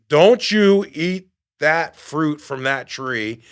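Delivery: slow, loud, commanding voice of God; stern divine command. Retold or imitated. God forbidding the fruit in the Bible story, stern command. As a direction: boom it out slowly as a solemn prohibition.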